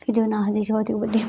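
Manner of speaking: ordinary delivery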